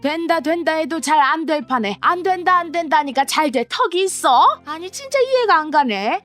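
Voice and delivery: put-on self-righteous stepmother talking down to a young woman; scolding stepmother. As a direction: lecture her like an indignant stepmother. Stepmother exasperated.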